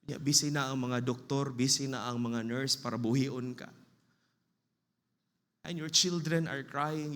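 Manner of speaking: ordinary talk, nothing marked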